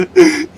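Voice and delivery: shrill burst of laughter; high laugh. Real, not acted. Cracking up at own joke.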